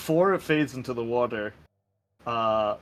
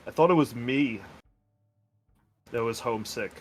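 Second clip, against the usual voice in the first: lightly voiced homesick confession with long pauses; homesick Fionn, wistful. Player speaks as homesick Fionn.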